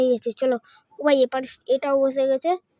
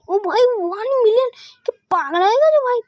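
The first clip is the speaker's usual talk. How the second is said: much higher and louder; excited; 10 semitones higher, 6 dB louder than usual. Real, not acted. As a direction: yell with delight at the million. Reaching one million. (উত্তেজিত হয়ে চেঁচানো)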